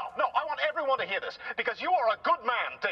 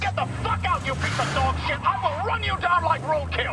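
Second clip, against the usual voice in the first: louder and higher, raging threats; enraged shouting; 6 semitones higher, 4 dB louder than usual. Acted. Car threatening to run the fan down, enraged. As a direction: scream him out of the room.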